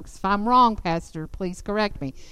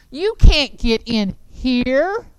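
slow, much louder jeering challenge; loud taunt; 3 semitones higher, 8 dB louder, much slower than usual. Acted. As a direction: bellow it to shut them out. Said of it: "when I'm doing that taunting".

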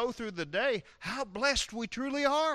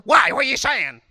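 loud, higher, affronted questioning; offended snap; 6 semitones higher, 14 dB louder than usual. Acted. Wife offended when husband offers to pray, loud snap. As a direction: bristle at the offer.

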